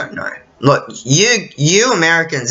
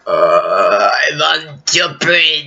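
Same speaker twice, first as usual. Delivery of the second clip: slow moaning zombie drone; slow mock zombie moan; 3 semitones lower than usual. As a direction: groan it out like a shambling undead. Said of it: "zombies are like".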